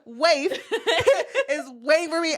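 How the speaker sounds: words broken up by real laughter; laughing. Real, not acted. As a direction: giggle your way through the line. Laughing through her own line.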